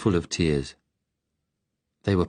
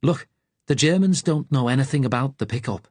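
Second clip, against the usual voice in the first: put-on Liverpudlian accent; 7 semitones higher than usual. A narrator's reading. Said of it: "The Liverpudlian".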